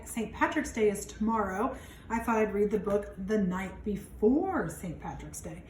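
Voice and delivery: ordinary delivery, nothing marked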